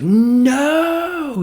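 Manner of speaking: a robot learning the awful truth; anguished no. Retold or imitated. Marcus voiced in shocked despair.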